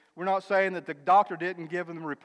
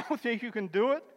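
normal talking voice

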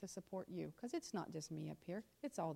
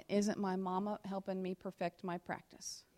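ordinary talk, nothing marked

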